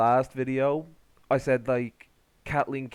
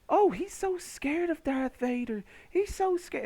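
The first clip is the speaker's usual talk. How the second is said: very high, whiny mocking voice; mock fear; 13 semitones higher than usual. Host ridiculing Obi-Wan running from Vader, mock fear. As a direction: sneer it in a squeaky falsetto.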